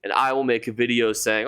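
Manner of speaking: usual speaking voice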